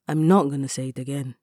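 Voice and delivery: usual speaking voice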